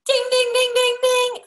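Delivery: high sung ding ding like a prize bell; bell sound effect. Pretend. A salesperson hearing a clerk is tired, mock bell chime. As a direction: ring it out like a game-show jackpot.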